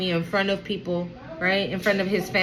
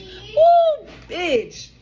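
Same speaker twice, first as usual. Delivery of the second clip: excited whoop; 10 semitones higher, 4 dB louder than usual. Real, not acted. Celebrating the viewer's card.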